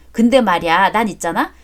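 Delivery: ordinary talk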